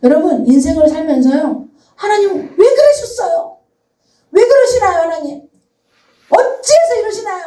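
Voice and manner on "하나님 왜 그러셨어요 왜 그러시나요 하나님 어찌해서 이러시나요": much higher, pleading whine; preacher imitating her own complaints to God, much higher and whiny; complaining to God. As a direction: moan at God like you always do. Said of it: "이런 이야기를 제가 많이 하고 있더라고요".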